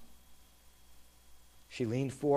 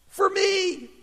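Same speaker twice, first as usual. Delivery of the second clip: put-on a boy who can hardly believe it; excited; 13 semitones higher than usual. A narrator's reading. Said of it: "he thought with excitement".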